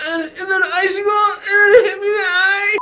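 loud, high blubbering kid impression; Ralphie. Child explaining injury to mom, blubbering wail. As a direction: wail through it like a crying child. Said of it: "like Ralphie in Some Christmas Story".

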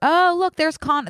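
sudden high excited call. Real, not acted. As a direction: burst out excitedly at something spotted.

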